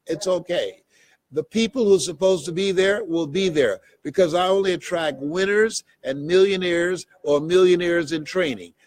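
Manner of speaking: normal talking voice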